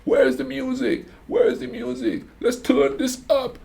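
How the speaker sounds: put-on high, loud party-kid yell; imitated screaming kid. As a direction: holler like a rowdy American kid livening up a party. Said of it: "He's just screaming".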